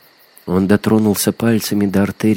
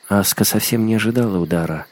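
ordinary delivery, nothing marked

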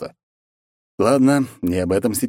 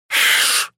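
long voiceless hiss; lizard hiss. A narrator's reading. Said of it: "зашипел на меня один из них".

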